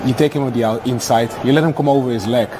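ordinary talk, nothing marked

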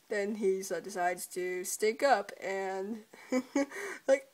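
ordinary delivery

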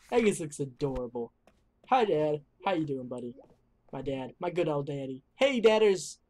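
ordinary talk

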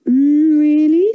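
wolf faking granny. A narrator's reading. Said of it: "said the wolf".

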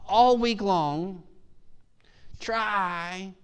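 high, drawn-out preaching cadence; impassioned preaching. Real, not acted. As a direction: urge the congregation with conviction. Preaches at full force.

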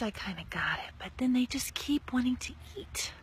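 usual speaking voice

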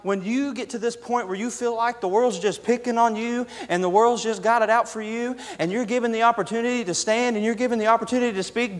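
ordinary talk, nothing marked

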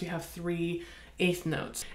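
ordinary talk, nothing marked

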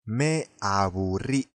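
"Me aburrí" said slowly and clearly; slow correction. Shown as a demonstration. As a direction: stretch out "Me aburrí" gently. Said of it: "teacher corrects aburrido".

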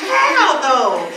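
high, loud mock-baffled outburst; baffled reaction. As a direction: blurt it in exaggerated disbelief. Acts out baffled reaction to posts.

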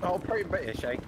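mock British accent. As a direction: put on a teasing British accent. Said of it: "in a British accent".

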